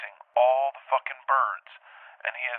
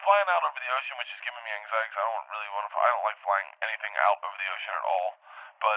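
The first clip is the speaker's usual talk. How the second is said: usual speaking voice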